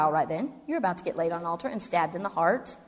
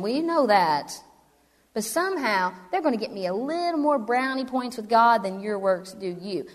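normal talking voice

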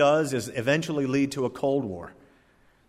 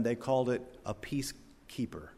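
whisper; 10 dB quieter than usual. Real, not acted. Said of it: "whispered punchline".

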